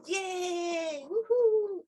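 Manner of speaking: high, drawn-out mock cheer; playful cheer. Shown as a demonstration. Teacher likens the letter's name to a cheer, playful high whoop. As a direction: stretch it out high like celebrating.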